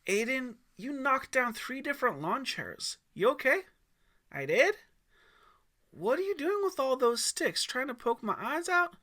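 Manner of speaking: half-laughing dad checking on his son; dad laughing at his son after the crash; amused dad. A narrator's reading.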